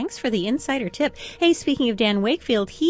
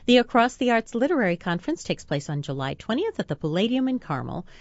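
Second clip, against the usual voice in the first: ordinary delivery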